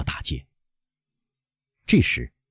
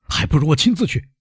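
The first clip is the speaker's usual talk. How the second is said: high, urgent exclamation; desperate resolve in a raised voice; agitated cry; 9 semitones higher than usual. A narrator's reading. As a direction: cry it out, pitch jumping up. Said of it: "威勒夫喊道".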